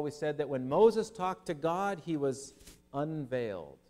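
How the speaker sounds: ordinary delivery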